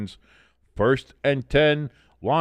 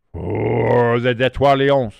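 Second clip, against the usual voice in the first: "Detroit Lions" said the French way, "Tetwal Ion", drawn out; slow, louder "Detroit Lions" with a hammed-up French accent; mock French accent; 6 dB louder, slower than usual. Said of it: "pronouncing the Detroit Lions with a French accent".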